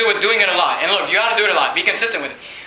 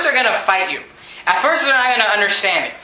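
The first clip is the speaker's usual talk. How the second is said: ordinary delivery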